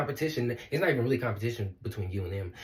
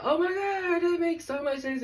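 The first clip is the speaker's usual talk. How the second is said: much higher, squealing oh my God; amazed realization; slower than usual. Retold or imitated. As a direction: relive the moment it clicked. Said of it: "I was like".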